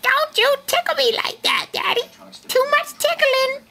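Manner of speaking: put-on very high squeaky baby voice; funny baby voice. Dad voicing his baby in a funny voice. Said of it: "that funny voice".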